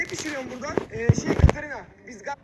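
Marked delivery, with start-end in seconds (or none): 0.9-1.8 sudden loud call. Acted. Sudden loud outburst mid-line.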